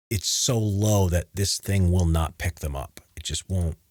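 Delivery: ordinary talk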